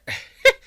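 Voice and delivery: loud delighted laugh; burst of laughter. Acted. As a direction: laugh out in pleased surprise. Offered imported liquor.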